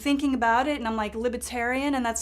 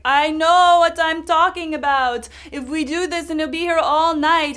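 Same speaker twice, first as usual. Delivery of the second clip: European man insisting; 6 semitones higher, 11 dB louder than usual. Retold or imitated. Poll worker insisting to the crowd, loud high accented voice. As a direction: hold your ground like an exasperated official. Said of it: "And he was like".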